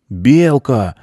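put-on high child's happy cry; joyful shout. A narrator's reading. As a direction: call it out gleefully as a boy. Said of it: "закричал радостно Миша".

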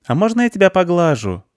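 put-on high-pitched girl's question; pleading little girl. Girl asks the cat to let her pet him, high and eager. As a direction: ask in a high child's voice.

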